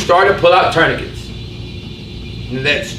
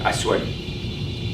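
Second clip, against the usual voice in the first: hushed, slow whisper; 7 semitones lower, 11 dB quieter, slower than usual. Real, not acted. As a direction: breathe it as a secret. Whispered repeat.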